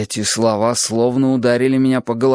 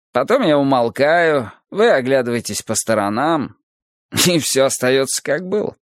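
lightly voiced teacher, higher than the narration; Adonis expounding; 6 semitones higher than usual. Adonis explains the code of human simulation, raised lecturing voice. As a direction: expound it in a lifted, insistent lecturing voice.

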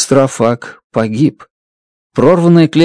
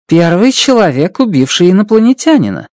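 teasing; 6 semitones higher than usual. Acted. Strat teasing him.

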